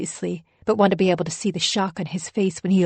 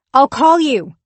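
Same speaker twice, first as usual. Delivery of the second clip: loud call over the shoulder, higher; yelling; 7 semitones higher, 10 dB louder than usual. A narrator's reading. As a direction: shout it while leaving. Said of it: "I yell on the way out".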